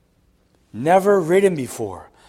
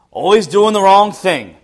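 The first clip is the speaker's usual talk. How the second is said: raised, louder mock judgement; prideful scorn; 5 semitones higher, 5 dB louder than usual. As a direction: put on a self-righteous complaint. Mocking his own prideful judgement.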